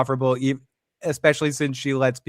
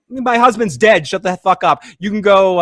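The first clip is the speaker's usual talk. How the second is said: louder, higher angry retort; acted angry outburst; 5 semitones higher, 5 dB louder than usual. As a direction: lash out as the grieving wife, voice raised. Acted quarrel from TV scene.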